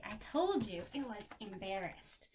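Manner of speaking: usual speaking voice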